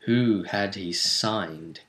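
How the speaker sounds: usual speaking voice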